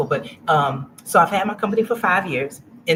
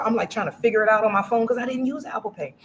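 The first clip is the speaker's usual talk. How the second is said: normal talking voice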